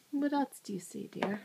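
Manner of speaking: ordinary talk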